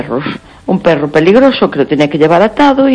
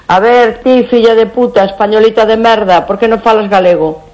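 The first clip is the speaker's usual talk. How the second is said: higher-pitched quoted scolding; nationalists' insults; 5 semitones higher than usual. Retold or imitated. Caller quoting what nationalists told her, mock scolding. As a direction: imitate a hectoring demand, pitched up. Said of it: "me decían los nacionalistas".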